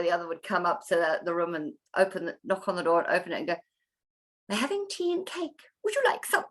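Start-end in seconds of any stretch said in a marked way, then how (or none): 4.5-6.4 sweet parent voice. Retold or imitated. Parent offering tea to crying child.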